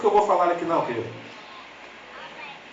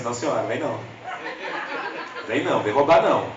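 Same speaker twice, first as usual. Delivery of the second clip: laughing protest; 4 semitones lower than usual. Retold or imitated. Protesting the measurement.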